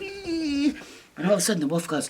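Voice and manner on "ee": high squeal; squealing pigs. Acted. Pigs running to the corner, high squeal. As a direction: squeal like a scared pig. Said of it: "run over ... going".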